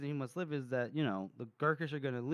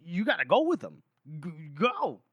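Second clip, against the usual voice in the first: higher, louder, stumbling urgency; urgent stammering plea; 4 semitones higher, 4 dB louder than usual. Retold or imitated. Temple stammering at Hadish to go with him, urgent. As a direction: push him out the door in a rush.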